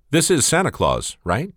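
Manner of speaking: put-on a small child checking who answered; little girl voice.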